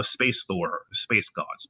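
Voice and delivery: usual speaking voice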